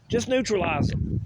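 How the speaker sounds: "neutralize" punched loud and high; forceful repeat. Real, not acted. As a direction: spit out "Just neutralize them" hard. Repeats the phrase, then hammers it.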